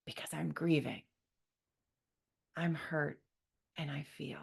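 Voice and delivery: soft unvoiced whisper. Real, not acted. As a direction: breathe it out as a whisper. Whispered confession.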